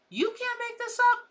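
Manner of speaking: high, amazed exclamation; excited disbelief. Real, not acted. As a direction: say it like it's unbelievable. Excited aside about donation.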